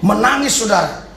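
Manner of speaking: normal talking voice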